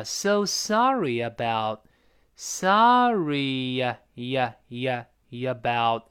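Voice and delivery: "sorry about" stretched slowly into "sorry-ya-bout"; slow-motion linking of "sorry" into "about"; linking y glide. Shown as a demonstration. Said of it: "得用烟来过渡一下".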